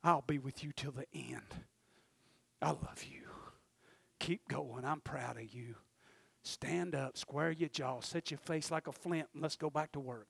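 very soft, low, half-whispered encouragement; imitated whisper in the ear. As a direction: play God murmuring close and reassuring. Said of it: "I just need him to whisper in my ear and say".

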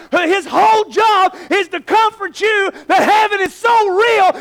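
loud, high revival preaching; preacher shouting to his church; preaching at full force. Acted. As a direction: lift it and shout to the congregation.

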